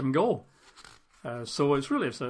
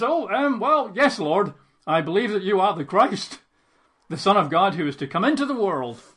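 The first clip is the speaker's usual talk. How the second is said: flustered Martha; 5 semitones higher, 5 dB louder than usual. Acted. Martha's hesitant reply voiced.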